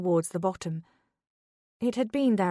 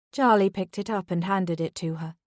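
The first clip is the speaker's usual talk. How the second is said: ordinary delivery, nothing marked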